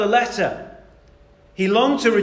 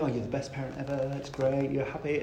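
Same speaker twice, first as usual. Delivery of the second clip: soft, lower mock-contented child; smiling child imitation; 4 semitones lower, 9 dB quieter than usual. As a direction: quickly imitate a pacified, happy child. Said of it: "like, smiling".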